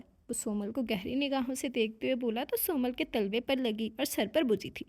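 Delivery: usual speaking voice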